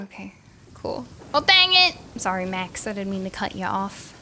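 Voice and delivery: ordinary talk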